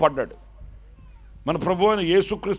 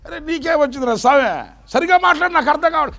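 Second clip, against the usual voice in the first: high, loud pleading; alarmed wife; 8 semitones higher, 4 dB louder than usual. Retold or imitated. Wife begging her mute husband, high and pleading. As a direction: beg him to speak properly.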